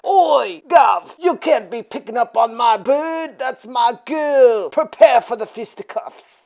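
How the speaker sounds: loud, hammed-up British tough; mock British geezer. British boyfriend confronting Dracula over his girl, mock tough. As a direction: challenge him in a broad British accent.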